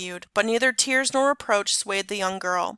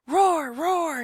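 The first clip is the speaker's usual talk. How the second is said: playful dragon roar; 7 semitones higher than usual. A narrator's reading. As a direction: roar at the soldiers' horses like a little dragon. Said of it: "he cried".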